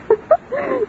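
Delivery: a high, delighted society laugh; gleeful laugh. Acted. Alicia laughing at the memory of ruining Eustacia, gleeful laugh. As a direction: cackle with pleasure at an old rival's downfall. Alicia recalls quarrel with glee.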